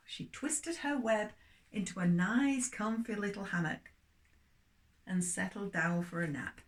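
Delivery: lively storybook reading for little ones; story reading for children. Acted. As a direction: tell it like a bedtime story to children.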